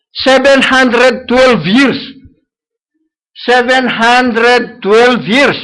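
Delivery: drawn out slowly, each number weighed; slow emphasis. Acted. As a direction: stretch it out to show how long it was. Preacher stresses the long time.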